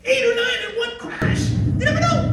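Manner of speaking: squealing with laughing excitement at the memory; thrilled squeal. Real, not acted. Guide marvelling to the audience, high excited giggle.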